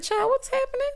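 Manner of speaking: high, shocked mock gasp; startled high squeal; mock alarm. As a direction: squeal it like you can't believe it. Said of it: "I said".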